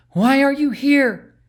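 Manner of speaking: high, loud, slow alarmed question; startled queen calling up at the bird; a frightened queen demands to know why; alarmed cry. A narrator's reading. Beatrice alarmed at Omen.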